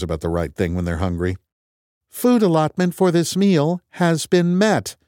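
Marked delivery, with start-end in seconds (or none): 2.1-5.0 high, slow machine voice; computer voice. Acted. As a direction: repeat it like a patient robot. Computer repeats reply.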